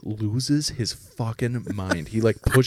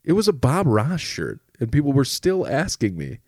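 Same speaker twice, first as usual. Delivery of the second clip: ordinary talk, nothing marked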